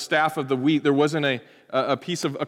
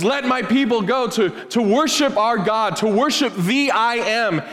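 Moses demanding; 7 semitones higher than usual. Retold or imitated. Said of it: "Moses confronts Pharaoh and says".

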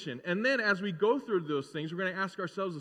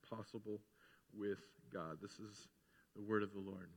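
measured, softer Bible reading; scripture reading; 7 semitones lower, 6 dB quieter than usual. Acted. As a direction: read the passage with reverence. Reads scripture aloud.

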